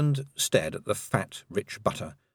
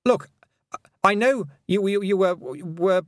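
put-on higher, halting embarrassed boy; flustered stammer; 10 semitones higher, 3 dB louder than usual. A narrator's reading. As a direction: trip over the words, voice up. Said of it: "Roland began, going red".

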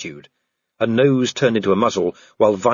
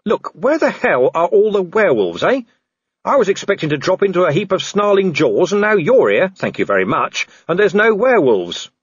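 higher, emphatic demand for answers; Vimes, exasperated; 7 semitones higher than usual. Acted. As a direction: rant at your rescuer, incredulous. Vimes rants at his rescuer.

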